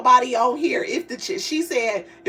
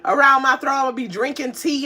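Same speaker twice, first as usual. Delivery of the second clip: ordinary talk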